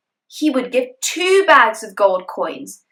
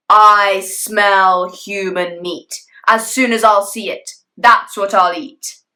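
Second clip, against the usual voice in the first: slow, louder growling dragon menace; dragon threat; 6 dB louder, slower than usual. Acted. Father dragon in bed threatens the hidden boy, slow and loud. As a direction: loom over the room, sniffing for prey.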